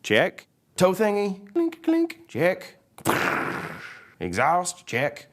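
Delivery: high character voice ticking off a checklist; Mater. Acted. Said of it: "And Mater says".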